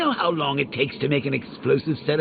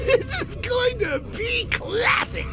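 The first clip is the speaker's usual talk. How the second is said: gleeful glee. Acted. Joker gloating.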